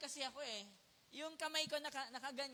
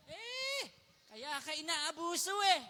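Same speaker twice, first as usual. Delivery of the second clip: scornful jab, raised pitch; mocking others; 4 semitones higher, 6 dB louder than usual. People mocking someone who never fights back, scornful. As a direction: sneer it like a know-it-all neighbour. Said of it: "sabi ng iba".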